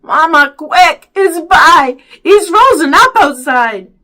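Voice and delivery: loud, high alarm; urgent shouting. A narrator's reading. Boy runs in to fetch help, urgent shouting. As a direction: blurt the emergency out.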